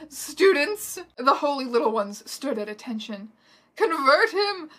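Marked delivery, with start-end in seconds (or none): 0.1-1.0 loud, high rallying call; calling to attention. A narrator's reading. Dumbledore calling his students, commanding shout. 3.8-4.7 high, loud dramatic order; brave command. A narrator's reading. Dumbledore rallying students against Voldemort, brave command. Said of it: "Dumbledore commanded bravely".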